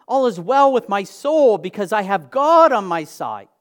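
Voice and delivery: loud, high preaching climax, swelling on God; full-force preaching. Acted. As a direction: proclaim it to the whole room. Preaching climax about God's peace.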